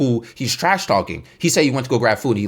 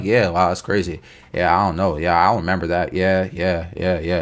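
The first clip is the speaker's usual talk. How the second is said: low, passive mumbled agreement; imitated low, uninvested yeah-yeahs; noncommittal; 7 semitones lower than usual. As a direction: mimic bystanders just going along. Bystanders just responding, not joining insults.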